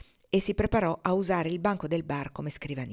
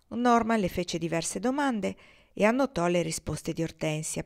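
ordinary delivery

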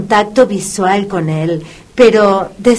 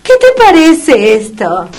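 put-on baby talk to a dog; 8 semitones higher than usual. Vet coaxing the puppy with a toy, high excited baby talk. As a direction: coax the dog like a toddler.

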